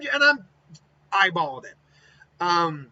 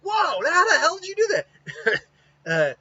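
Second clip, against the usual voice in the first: high, slowed, amazed exclaim; wide-eyed wonder at a trick; excited kid; 13 semitones higher, 5 dB louder, slower than usual. Acted. Naruto amazed by the twins' technique, high and excited. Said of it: "It's like".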